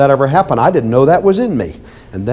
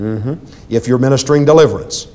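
ordinary delivery

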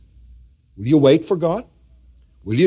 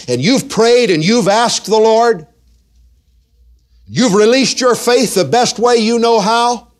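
rising, louder preacher's cadence, pitched up; preaching; 9 semitones higher, 4 dB louder than usual. Acted. Pastor exhorting his congregation, full preaching voice.

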